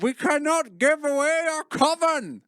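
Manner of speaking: high, slow theatrical whisper-cry; hammed-up secret coven; dramatic vampire.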